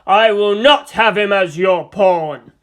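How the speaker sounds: loud, slow, indignant roar; outraged warrior laying down the law; spat. Acted. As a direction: thunder a furious refusal, hitting every word. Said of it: "the Space Marine spat".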